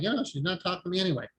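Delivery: fast. Retold or imitated. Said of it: "Be like".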